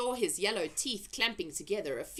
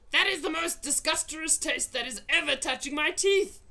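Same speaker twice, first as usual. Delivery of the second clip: loud, higher angry rant; ranting giant; 9 semitones higher, 5 dB louder than usual. A narrator's reading. Blood bottler scolding the BFG, loud rant. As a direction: rant at him in disgust.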